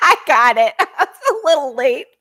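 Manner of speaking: high, real laughing voice; high-pitched giggly amusement. Real, not acted. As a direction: crack up while talking. Laughing at chat joke.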